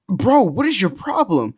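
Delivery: high, drawn-out exasperated protest; exasperated confrontation. Retold or imitated. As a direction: retell it as an incredulous, stretched-out challenge. Retold argument with angry stranger.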